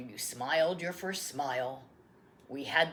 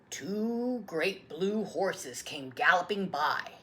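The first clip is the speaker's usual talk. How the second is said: ordinary delivery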